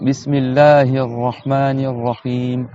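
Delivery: slow, measured Quranic chant; Quran recitation. Shown as a demonstration. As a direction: recite it slowly and solemnly. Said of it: "on va faire la Fatiha avec la Basmala".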